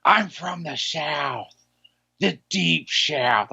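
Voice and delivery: slow Southern drawl; Southern accent. Acted. Over-the-top Southern drawl bit.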